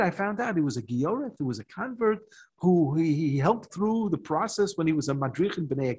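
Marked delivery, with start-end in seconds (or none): none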